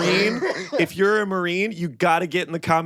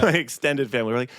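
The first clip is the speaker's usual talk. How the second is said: ordinary talk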